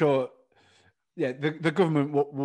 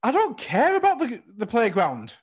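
much higher, louder outraged protest; indignant kid; 9 semitones higher, 5 dB louder than usual. Retold or imitated. Kid protesting to the dinner lady, high and loud. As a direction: cry out that you're the one hurt.

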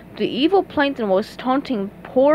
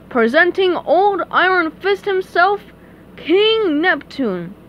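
high, grand herald's announcement; squire announcing; 7 semitones higher, 4 dB louder, slower than usual. A narrator's reading. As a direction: proclaim it, stretching the king's name.

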